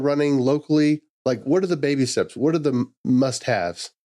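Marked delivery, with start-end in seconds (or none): none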